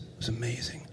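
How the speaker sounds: whispered in awe. Real, not acted. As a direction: breathe it out as a secret. Remembering peace in the room.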